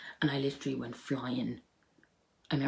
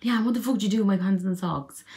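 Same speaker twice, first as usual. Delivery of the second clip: annoyed sister; 6 semitones higher, 9 dB louder than usual. Retold or imitated. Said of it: "she'd be like".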